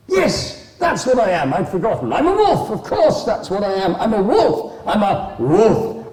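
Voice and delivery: high, loud bursts of sudden recognition; forgetful wolf, excited. Acted. Vacant wolf remembers who he is, loudly overexcited. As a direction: startle awake and gabble with delight, high and loud.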